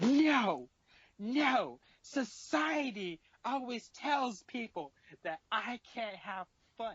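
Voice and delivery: put-on high, slow drunken protest; mock drunk wailing. Drunk girl lecturing her friend about society, mock wail. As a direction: imitate a drunk girl whining in long vowels. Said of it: "as she's crying about getting dragged".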